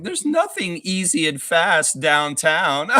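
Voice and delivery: slow, high mocking voice with a laugh; exaggerated high-pitched imitation of a scoffer; mocking stranger impression. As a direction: mimic a laughing know-it-all, drawn out. Mimics the lady laughing at them.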